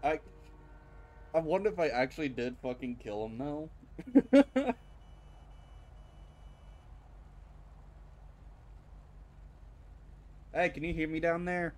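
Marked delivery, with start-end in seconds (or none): 3.8-10.5 high cackle. Real, not acted. Laughs alone on bridge. 10.5-11.7 calling loudly down from above; calling down a hallway. Real, not acted. I don't know if they could even hear me.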